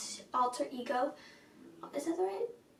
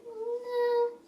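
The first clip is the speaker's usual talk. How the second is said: drawn-out reluctance; 8 semitones higher than usual. Retold or imitated. Acts out own reaction.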